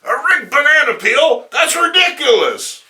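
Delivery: high, loud incredulous scoff; mock outrage at a silly idea; scoffing disbelief. A listener scoffing at the rigged banana peel, loud.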